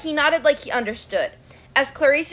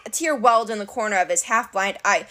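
ordinary delivery

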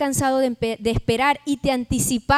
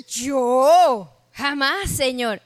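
mock shocked denial; much slower than usual. Acts Sara caught laughing, denying.

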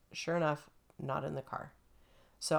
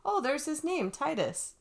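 much higher, mock-surprised realization; surprised discovery; 11 semitones higher than usual. The finder spotting the phone number, surprised realization. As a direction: play the finder suddenly noticing.

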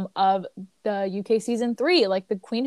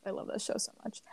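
wistful whisper; soft, sad whisper; 14 dB quieter, faster than usual. Real, not acted. Sad aside about beloved show.

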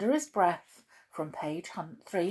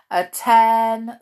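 barked command; 5 semitones higher, 12 dB louder than usual. Acted. Boot barks a command.

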